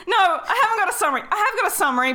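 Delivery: fast, high, laughing; giggly quick backtrack. Real, not acted. Flustered laughing retraction.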